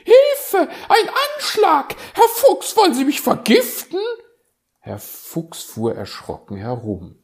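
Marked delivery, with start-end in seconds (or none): 0.0-4.0 very high, loud shrieking cry for help; shrieking magpie. Acted. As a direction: screech it like a panicked bird. Elster kreischt aus Schreck. (sehr hoch, laut kreischende Elster)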